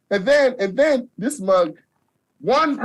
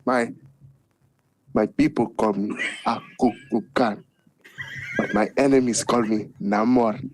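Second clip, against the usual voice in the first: Namor impression; 12 semitones lower than usual. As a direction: intone it low like a god-king. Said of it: "our antagonist, Namor".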